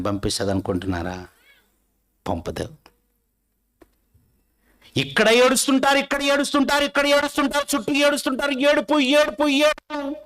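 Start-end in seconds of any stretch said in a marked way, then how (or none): 5.0-10.2 high, loud, rhythmic repetition; fiery preaching. Acted. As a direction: preach it with rising fervour. Preaching at full force.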